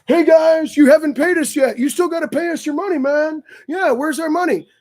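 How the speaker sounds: hammed-up pushy driver demanding money; a mock taxi driver shaking them down. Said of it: "as soon as we landed".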